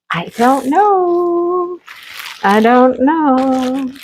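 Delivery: drawn-out undecided sighing; unsure. Real, not acted. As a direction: stretch it out, torn between choices. Indecisive about which kit.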